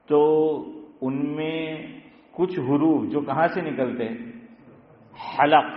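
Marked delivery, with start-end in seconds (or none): 5.4-5.6 حلق with a strong ha from the throat; throat letters. Shown as a demonstration. Teacher showing where letters come from, throaty articulation. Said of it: "حروف حلقی جس کو بولتے ہیں".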